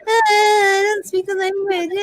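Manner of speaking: high, slow, whimpering mock whine; slow, high crybaby imitation; whiny mock complaint. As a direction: mimic a spoiled complainer, crying it out. Mocking sister-in-law's complaint.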